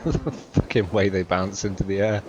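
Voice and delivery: laughing through the words. Real, not acted. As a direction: giggle while saying it. Laughing at the deer bouncing.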